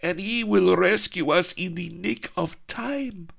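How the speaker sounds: put-on high, airy young girl's voice; dreamy damsel sighing for her rescuer; swooning maiden. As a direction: lovestruck girl. Melliflua's dreamy line.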